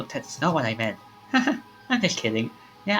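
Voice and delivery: ordinary talk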